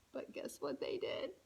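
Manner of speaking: unvoiced, secretive question; whisper. Shown as a demonstration. Reader teasing the child with a whispered question. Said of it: "teasing whisper to child".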